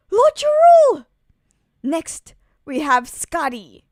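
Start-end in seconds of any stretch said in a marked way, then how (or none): 0.1-1.0 calling out. Acted. Giant calling out to elder.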